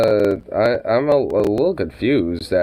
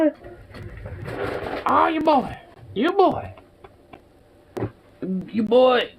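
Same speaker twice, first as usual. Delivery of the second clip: much higher sing-song coaxing; pet talk; 10 semitones higher than usual. Real, not acted. Owner coaxing the dog to come, high cooing pet voice.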